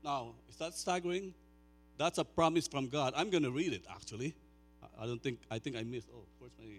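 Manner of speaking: high, loud, fervent preaching; preaching at full force. Acted. Pastor preaching Romans eight loudly to the congregation. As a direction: drive the promise home to the room.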